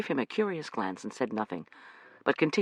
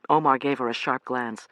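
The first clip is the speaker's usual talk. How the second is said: ordinary delivery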